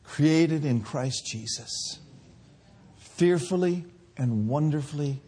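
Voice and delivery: hushed reverent sermon close; tender preaching. Acted. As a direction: gently draw them into awe. Soft closing reflection.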